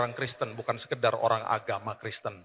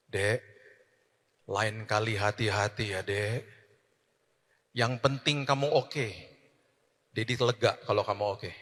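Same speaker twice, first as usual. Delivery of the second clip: tender father; 4 semitones lower than usual. Retold or imitated. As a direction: soothe the crying boy gently. Father comforts crying son.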